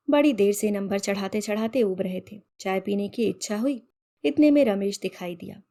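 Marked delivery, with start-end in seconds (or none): none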